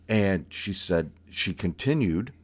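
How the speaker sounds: plain reading voice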